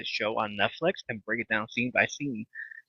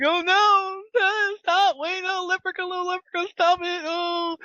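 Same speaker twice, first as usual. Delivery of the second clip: very high squealing mock protest; Garth impression; 5 dB louder, slower than usual. Retelling Wayne's World, very high squealing Garth. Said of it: "and Garth's like".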